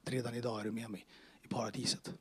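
soft whispered reassurance; hushed words of Jesus; whispered promise. Retold or imitated. Jesus' answer retold again.

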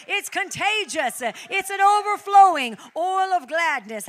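high, loud, ecstatic preacher's cry; shouted praise over the congregation; full-force preaching shout. Acted. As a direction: lift the roof with joy.

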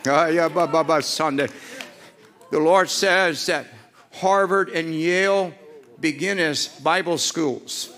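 loud, high preaching at full force; shouted prophecy. Real, not acted. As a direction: proclaim it with rising fire.

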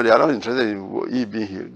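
ordinary talk, nothing marked

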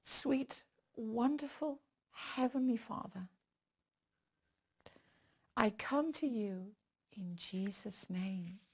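soft slow prayer voice; hushed intimate prayer. Acted. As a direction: pray quietly and reverently. Leads prayer.

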